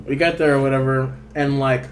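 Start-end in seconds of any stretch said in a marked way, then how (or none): none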